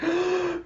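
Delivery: high, soft gasp of wonder; mock gasp. Acting out Hinata's reaction, mock amazed gasp. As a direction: suck in air as if amazed.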